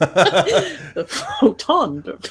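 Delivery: mock-solemn overemphasis on "photon"; wrong word stressed. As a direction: stretch "photon" absurdly, through laughter. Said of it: "The least logical words is the one".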